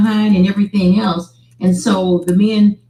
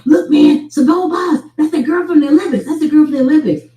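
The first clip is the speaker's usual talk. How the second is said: excited guy voice; 8 semitones higher, faster than usual. Retold or imitated. Football players ogling celebrity website.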